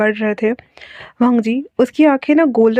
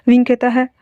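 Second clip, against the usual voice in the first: plain reading voice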